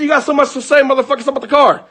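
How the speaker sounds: high, rushed confrontational dare; retold angry challenge. Husband daring the boyfriend to step out, retold anger. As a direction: re-enact goading him out, fast and high.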